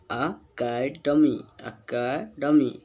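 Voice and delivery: अकेडमी drawn out, syllable by syllable; teacher sounding out अकेडमी in separate beats; syllable-by-syllable model pronunciation. Shown as a demonstration. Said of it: "ई से यहाँ पर हमारा आ का sound".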